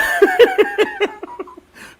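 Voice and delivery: shrieking high laugh. Real, not acted. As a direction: crack up in a high squeal. Cat named Shithead story.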